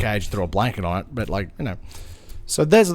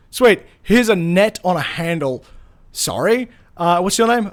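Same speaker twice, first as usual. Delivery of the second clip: louder, lightly voiced brisk woman handing out gear; a hint of a bossy rescuer, hurried and louder; brisk organizer; 5 semitones higher, 7 dB louder than usual. Rescuer handing out gear to the boys, brisk and louder. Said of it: "And she goes".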